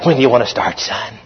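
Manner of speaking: low, soft, menacing instructor line; lightly voiced gloating trainer; sadistic instructor. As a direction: quietly relish the new victim. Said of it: "they're kind of sadistic".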